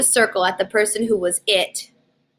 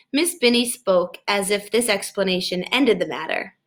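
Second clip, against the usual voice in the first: plain reading voice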